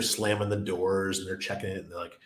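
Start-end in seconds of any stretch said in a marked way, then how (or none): none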